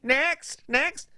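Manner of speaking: high-pitched cashier's call; mock a cashier ignoring someone, calling high; repeated high, dismissive call. Acted cashier calling over customer.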